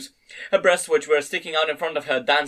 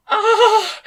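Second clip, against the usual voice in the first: very high loud cry; loud scream; 8 dB louder than usual. A narrator's reading. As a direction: let out a sharp, high scream. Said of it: "Screaming loudly".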